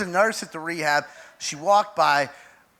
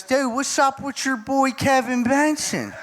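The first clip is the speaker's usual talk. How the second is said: higher-pitched, needling uncle on a call; uncle impression; 6 semitones higher than usual. Uncle phoning his nephew in the car, needling. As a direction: needle him in a raised voice. Voicing Uncle Jim on the phone.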